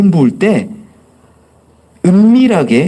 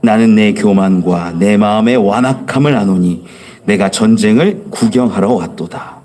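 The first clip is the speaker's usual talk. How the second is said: lower, steady recitation of a Bible verse; scripture reading; 7 semitones lower than usual. Acted. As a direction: read the verse together, evenly. Congregation reads scripture aloud. (성경 봉독)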